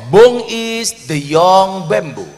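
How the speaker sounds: slow, loud, stretched English; deliberate English answer. Retold or imitated. Preacher explaining bung to a foreign guest, slow loud English. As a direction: spell it out for the foreigner. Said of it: "Akhirnya saya jawab".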